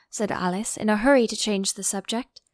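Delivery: ordinary delivery, nothing marked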